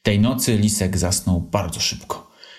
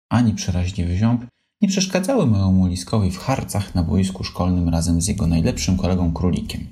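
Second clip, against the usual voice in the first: usual speaking voice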